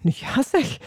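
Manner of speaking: high, indignant exclamation; deeply offended. A narrator's reading. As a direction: take offence, pitch jumping up. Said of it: "zei Zebedeus diep beledigd". (diep beledigd, hoge toon)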